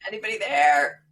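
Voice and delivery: a hollow, far-off call to spirits. Acted. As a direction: call into the empty room. Calling out to spirits.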